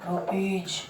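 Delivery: low, hushed "रुपीज" ending in j; final J sound. Shown as a demonstration. Teacher letting class hear the J, final J sound. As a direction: say "रुपीज" as ru-peej. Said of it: "जा की sound आ रही है".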